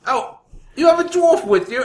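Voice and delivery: high, loud put-on guard voice; a squeaky guard noticing the dwarf; hammed-up high guard; mock guard voice. Retelling guards spotting dwarf.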